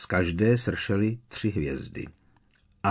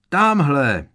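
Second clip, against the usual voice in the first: loud, high, pointing shout; 6 semitones higher, 7 dB louder than usual. A narrator's reading. Sixth-year spotting the carriage, loud shout. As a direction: call out to the whole crowd. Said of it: "vykřikl jeden student".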